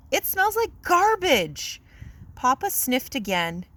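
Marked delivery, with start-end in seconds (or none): 0.1-1.8 higher, slower, drawn-out LIKE; mother stretching the words in disgust; Mama repeating. Acted. Mama repeats, voiced in story.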